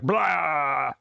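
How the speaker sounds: drawn-out "blah" imitating a face; mock blurted blah; blah noise. Describing his whole face, drawn-out blah noise. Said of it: "his whole face was all like".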